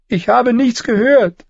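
put-on high tearful child's plea; crying. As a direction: weep it out in a high voice. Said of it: "weinte er".

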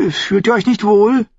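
put-on high child's voice; high-pitched young lord; boy, concerned. A narrator's reading. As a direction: ask after him in a high boyish voice.

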